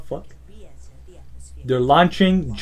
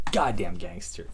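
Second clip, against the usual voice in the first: low, soft and quiet; quiet low admiration; low mutter; 10 semitones lower, 10 dB quieter than usual. Real, not acted. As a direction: murmur it under the breath. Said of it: "repeat after whisper".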